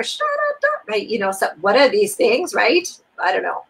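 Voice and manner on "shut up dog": mock yell. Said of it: "yelling out your door".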